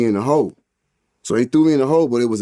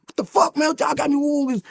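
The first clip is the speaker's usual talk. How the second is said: high, exasperated outburst; losing it. Retold or imitated. Woken at two to move, exasperated high outburst at the guard. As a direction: blow up at the guards, voice cracking high. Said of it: "I, I lost it".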